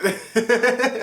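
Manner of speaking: high giggling laugh; real laugh. Real, not acted. Storyteller amused by spider with hairspray, giggling. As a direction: giggle at your own joke.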